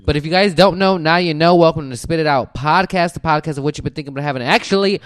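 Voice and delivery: loud podcast host welcome; podcast host intro. Acted. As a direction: welcome listeners like a radio host. Show intro again.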